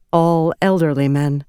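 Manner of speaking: ordinary delivery, nothing marked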